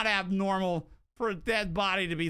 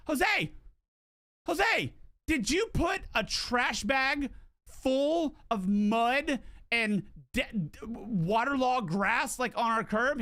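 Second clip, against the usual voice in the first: retold exasperated call; 5 semitones higher, slower than usual. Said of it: "I was like".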